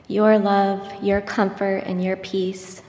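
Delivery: normal talking voice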